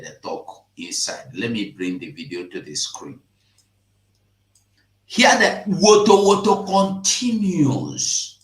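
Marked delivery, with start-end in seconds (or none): 5.1-8.4 1 excited exclamation. Real, not acted. Excited reaction to the video.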